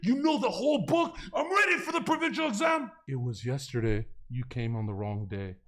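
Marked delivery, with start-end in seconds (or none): 0.0-2.9 triumphant shout. Retold or imitated. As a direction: shout it out sure of yourself. Confident student before exam.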